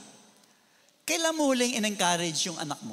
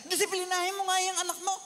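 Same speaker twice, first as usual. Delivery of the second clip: quick, higher bossy father; father's order; 8 semitones higher, faster than usual. Retold or imitated. Father ordering wife to discipline child, quick and higher. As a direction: toss the job to the wife. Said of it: "Sasabihin ng tatay".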